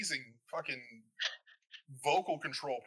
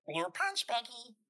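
higher-pitched cartoon alien voice; high, goofy creature call-out; Stitch; 10 semitones higher, slower than usual. Shown as a demonstration. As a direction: do a playful little alien impression. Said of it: "my kids ask me to sound like Stitch".

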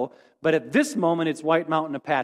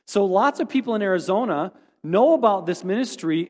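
normal talking voice

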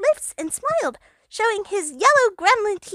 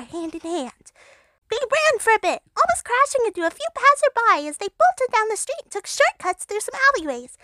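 usual speaking voice

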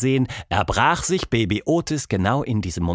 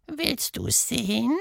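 very high, softer, slow creature voice; eerie creature voice; 14 semitones higher, 4 dB quieter, slower than usual. Acted. Pink caterpillar staring Tilda in the eyes, very high and soft. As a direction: lean in close and tempt her.

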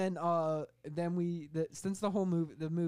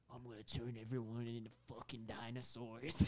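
low, quiet hushed celebrity mimicry; Clint Eastwood; 6 semitones lower, 8 dB quieter than usual. Paid actor saying his one line, low hushed impersonation. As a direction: drop it low and soft, mock-menacing. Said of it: "paid him to say one line".

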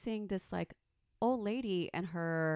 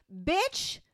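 raised, higher mock snap at the lady; retold angry clapback; 6 semitones higher, 5 dB louder than usual. Retelling how she told off the old lady, angry raised voice. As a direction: reenact telling her off, loud.

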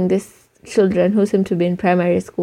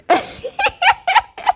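high squealing laugh; sudden squeal of laughter. Real, not acted. Laughs at own joke.

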